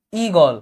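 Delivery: ঈগল with long ঈ and open গ; spelling pronunciation. Shown as a demonstration. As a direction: say ঈগল exactly as spelt. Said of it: "এই দীর্ঘি বা গ এভাবে উচ্চারণ করি না". (বানান অনুযায়ী দীর্ঘ ঈ দিয়ে ঈগল)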